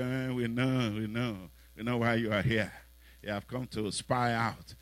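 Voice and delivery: put-on low, soft, dismissive older brother; sneering brother. Eliab mocking David for coming, low and dismissive. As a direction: brush the kid off with quiet scorn.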